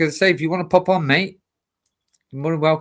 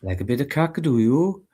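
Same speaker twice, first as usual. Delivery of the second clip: lilting Welsh demo, rising on "do you"; Welsh accent; 3 semitones higher than usual. Shown as a demonstration. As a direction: show how the catchphrase sounds in Welsh. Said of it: "How do you say it?".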